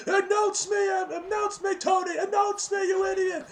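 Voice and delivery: high, loud, demanding imitation; hammed-up high-pitched shouted order; mock trash talk. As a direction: shout it like a pushy heel demanding attention. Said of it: "he's, like, talking his shit".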